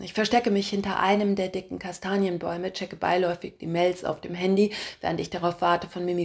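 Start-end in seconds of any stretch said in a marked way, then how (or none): none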